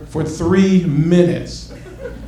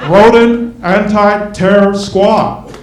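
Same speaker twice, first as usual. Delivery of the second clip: slow, booming trailer announcement; movie-trailer announcer; 3 semitones higher, 4 dB louder, much slower than usual. Acted.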